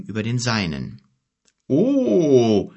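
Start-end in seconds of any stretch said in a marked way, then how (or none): 1.7-2.7 a hint of a rumbling giant; lightly voiced growling giant; deep rumbling giant. A narrator's reading. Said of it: "mit tiefer, grollender Stimme".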